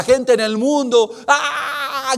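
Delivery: loud, pained preaching cry; a sob in a loud cry; anguished cry. Acted. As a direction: cry out the people's despair. End-times wrath, people screaming.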